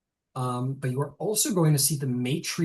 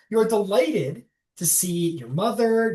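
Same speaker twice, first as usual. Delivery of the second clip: ordinary talk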